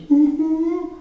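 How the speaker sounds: put-on high whimpering little-boy ooh; imitated child's sob. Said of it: "my little four-year-old sobbing".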